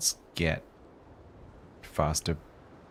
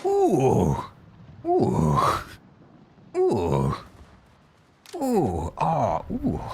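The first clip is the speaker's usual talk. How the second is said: excited; 7 semitones higher, 4 dB louder, much slower than usual. Real, not acted. Excited reactions to hits.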